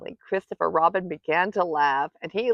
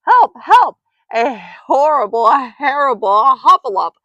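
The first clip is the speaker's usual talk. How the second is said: high, loud, words tumbling into muddle; panicked crying out; 7 semitones higher, 12 dB louder than usual. A narrator's reading. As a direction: shriek so frantically that the words get jumbled. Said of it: "still crying out".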